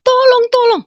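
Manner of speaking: desperate high cry for rescue; tearful plea. Retold or imitated. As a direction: beg for help through tears. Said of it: "Saya menangis".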